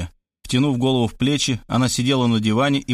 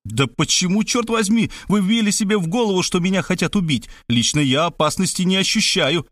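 higher-pitched indignant outburst; mild angry outburst, voice lifted; exploding in irritation; 7 semitones higher than usual. A narrator's reading. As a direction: protest hotly, exasperated. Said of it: "Я взорвался".

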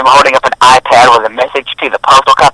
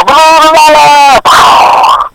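high-pitched blah-blah shouting; mock militant yelling; 11 semitones higher, much slower than usual. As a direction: imitate a ranting zealot in nonsense. Mimicking militants with blah noises.

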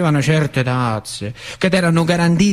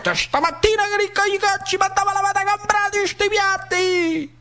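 voiced much higher as a fussing woman; scolding woman. Woman hushing the crying newborn, high scolding voice. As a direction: nag and hush at once, high woman's voice.

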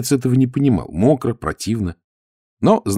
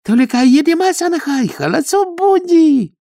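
eager Chinese suitor; 4 dB louder than usual. A narrator's reading. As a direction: promise her everything. Khodya pleads to go Shanghai.

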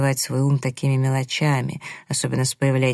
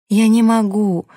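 higher, louder cry of anguish; pained cry; 6 semitones higher, 6 dB louder than usual. A narrator's reading. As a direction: cry it out with pain in the voice. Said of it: "с болью в голосе выкрикнула девушка".

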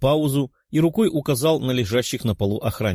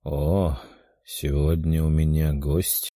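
weak voice; 7 semitones lower, slower than usual. A narrator's reading. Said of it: "раздался слабый голос мумии".

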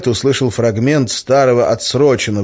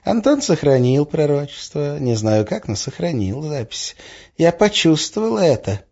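muttering woman; 7 semitones higher than usual. A narrator's reading. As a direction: mutter it high, half to yourself. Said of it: "пробормотала Арина". (бормотание высоким голосом)

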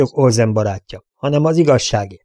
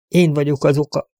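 higher-pitched admission of guilt; understated confession; 4 semitones higher than usual. A narrator's reading. Broken father blaming himself to the sheriff, understated confession. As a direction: own up to it, restrained.

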